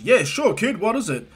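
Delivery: high, hearty hero voice; All Might. Acted. Hero granting a boy a favor, high and hearty. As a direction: answer big and obliging. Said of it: "And he goes".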